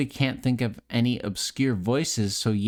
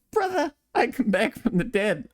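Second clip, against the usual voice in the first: high cocky Scout voice; Scout impression; 12 semitones higher than usual. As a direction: brag like Scout. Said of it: "Mimic Scout from T F two".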